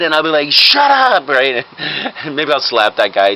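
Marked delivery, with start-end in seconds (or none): 0.5-1.3 loud, high mock outburst; irritated command. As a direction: cut someone off with acted anger. Doctor silences a bystander.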